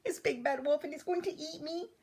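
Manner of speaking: very high, small voice; frightened duckling cry. A narrator's reading. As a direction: squeak for help like a baby duck. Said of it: "cried number five".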